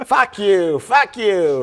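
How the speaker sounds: put-on loud Boston accent yelling; screaming. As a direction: yell it in rough Boston. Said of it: "it's just screaming".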